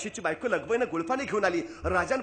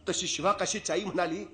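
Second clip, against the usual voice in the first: ordinary talk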